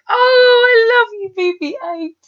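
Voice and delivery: high, loud swooning coo; gushing adoration. Retold or imitated. Viewer reacting to the droid's little flame, high gushing. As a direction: gush over it, starting high and loud.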